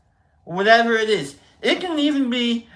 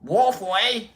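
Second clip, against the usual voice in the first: high mock Australian tic; bad Aussie accent; 4 semitones higher than usual. Showing the tic before every word, mock Australian accent. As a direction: exaggerate a crude Aussie filler. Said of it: "Before every word".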